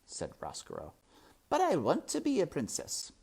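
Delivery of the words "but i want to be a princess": put-on high, pleading girl's voice; protesting servant girl. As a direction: protest high, like a thwarted child.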